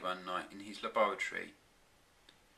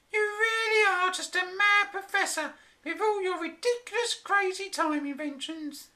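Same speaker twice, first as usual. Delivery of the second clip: high, complaining voice; moaning wife; 5 dB louder than usual. A narrator's reading. Flora complaining about the mad professor, high moaning voice. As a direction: moan at him, high and fed up. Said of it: "she moaned".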